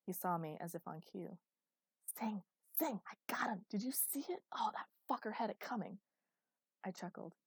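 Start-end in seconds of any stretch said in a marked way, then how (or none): 2.0-6.0 giddy, breathless whispered boasting; excited whisper. Acted. Prankster whispering his triumph to his friend, gleeful hush.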